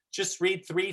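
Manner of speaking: ordinary delivery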